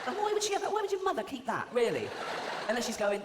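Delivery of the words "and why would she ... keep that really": high, incredulous disbelief; mock-baffled high questioning. Real, not acted. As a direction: sound baffled, pitch shooting up.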